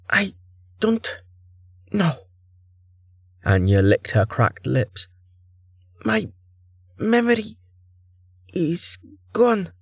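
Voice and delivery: put-on high, timid young heroine voice; small, scared and hurting; frightened young woman.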